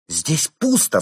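high, loud exclamation; sudden high-pitched outburst; shocked cry. A narrator's reading. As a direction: cry out in alarm at the discovery. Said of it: "вскрикнула она".

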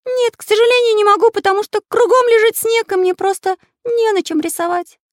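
very high, loud cartoon-like voice; anxious character voice. A narrator's reading. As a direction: explain helplessly in a squeaky-high pitch. Карандаш answers in despair. (высокий взволнованный голос)

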